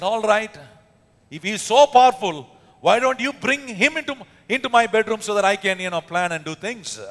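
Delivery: a hint of an arrogant king; mock scornful king. King voiced in retold story.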